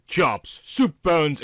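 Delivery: plain reading voice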